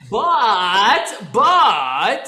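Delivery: loud, high, drawn-out interruption; booming segue. Real, not acted. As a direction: swoop each word up and down, loudly. Loud dragged-out change of topic.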